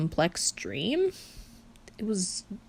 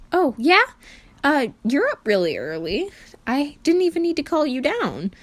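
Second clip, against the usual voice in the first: bright louder dad voice; cheery Patton voice; 8 semitones higher, 6 dB louder than usual. Acted. Patton greeting Virgil in the kitchen, cheery and loud. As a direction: sing out a cheery morning greeting.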